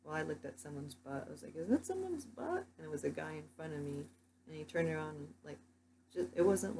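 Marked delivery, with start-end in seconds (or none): 1.6-2.6 high, incredulous mock question; high mock disbelief; mock puzzled surprise. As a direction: lift it like a startled double take. Retold own puzzled thought.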